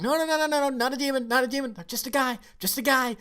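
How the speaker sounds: high-pitched, hurried mock denial; hero protesting. Hero telling hunters he's just a guy, mock protesting. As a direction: insist in a high put-on voice.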